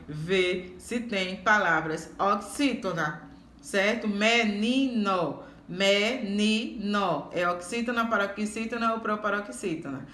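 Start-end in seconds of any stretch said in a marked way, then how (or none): 4.1-7.3 "Menino, menino" very slow, me... ni... no; slow classroom sounding-out of "menino", every syllable separate. Shown as a demonstration. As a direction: split "Menino" into three drawn-out syllables, each time. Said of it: "o pedacinho ni é que é".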